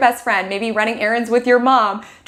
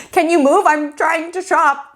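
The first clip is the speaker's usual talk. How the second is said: second take, patience gone; exasperated ask; 6 semitones higher, slower than usual. Retold or imitated. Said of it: "you're like".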